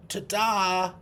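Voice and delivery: high, loud showman's ta-da; magician's ta-da. Pretend. Hand pointing at the learner, magician's triumphant ta-da. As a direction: pop it out like the big reveal of a trick. Magician figure in memory picture.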